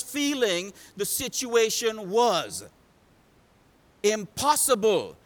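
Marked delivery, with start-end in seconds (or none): 4.0-5.2 high, loud voicing of a man's despair; impassioned, desperate sermon climax; full-force preaching. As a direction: cry out each phrase as if overwhelmed.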